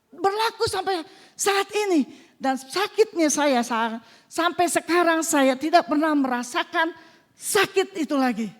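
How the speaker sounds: forceful, emphatic testimony preaching. Real, not acted. Testifying to the congregation that the miracle is real, fervent preaching. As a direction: proclaim the miracle with rising fervor.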